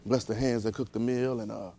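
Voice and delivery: low, soft, mumbled table prayer; mock long-winded prayer. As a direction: imitate a rambling blessing over the food. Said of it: "You know, we used to".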